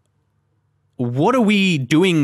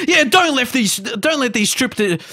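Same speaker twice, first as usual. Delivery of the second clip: excited shout; 10 semitones higher than usual. Real, not acted. Still buzzing after the save.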